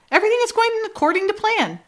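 high-pitched put-on reassurance; high, hammed-up calming line; mock reassurance. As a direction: imitate someone insisting all is well. Retold feigned calm while panicking.

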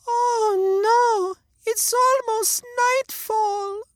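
put-on very high, slow frightened girl; a little girl close to tears; scared little girl. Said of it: "said Gretel".

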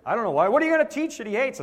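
flustered. Retold or imitated. Retold defensive answer on TV.

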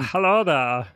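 a light Scots-Canadian lilt; mild Scots-Canadian accent; put-on customer accent. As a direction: greet like a Nova Scotian shopper. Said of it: "accent I'm going to use".

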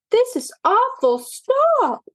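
high panicked plea; distressed cry. A narrator's reading. Kittens beg poodles to stop.

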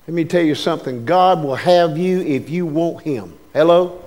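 usual speaking voice

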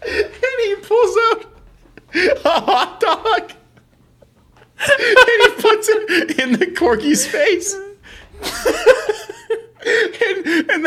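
real squealing laughter, very high pitched; helpless laughter. Real, not acted. Comic telling friend about hot dog in corgi's face, helplessly laughing. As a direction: barely get the story out through giggles.

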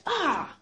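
a short high yelp from a crab bite; sudden mock cry of pain; startled cry. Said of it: "like this".

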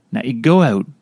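ordinary talk

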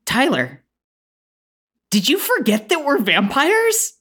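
pointed mother, pitch leaping up; Tyler's mom. Acted. Mother reminds Tyler of family secret, pointedly. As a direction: pause after the name, then go high. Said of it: "[name], who walked out of the crowd previously, says".

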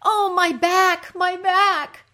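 high, slow, loud wail of pain; loud moan. Acted. Underworld queen suffering in her throne room, loud moan. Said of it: "Ereshkigal moans".

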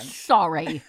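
ordinary talk, nothing marked

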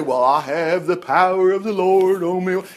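mock magical pronouncement. Parodying what David did not say, mock-grandiose. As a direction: intone it like a hammed-up wizard. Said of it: "He didn't say there was anything magical".

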